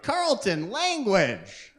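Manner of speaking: high, sharp mother's rebuke; scolding mom. Retold or imitated. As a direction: snap the name like a shocked wife. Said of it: "she'd be like".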